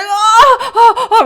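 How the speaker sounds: high, loud "oh, oh, oh"; acted gasping cries after the push; birth cries. Retold cries as baby came.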